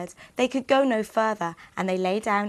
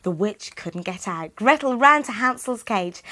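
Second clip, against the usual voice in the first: ordinary delivery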